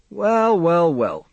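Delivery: high, then falling doctor's greeting. Acted. Doctor meets the boy Charlie, appraising greeting. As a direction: size up the new patient aloud.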